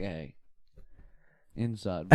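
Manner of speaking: quiet. Retold or imitated. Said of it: "And I'm like".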